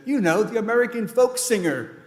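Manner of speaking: loud, high retort; imitated exasperated cousin. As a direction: raise the voice in a mock argument. Acted exasperated cousin in retold debate.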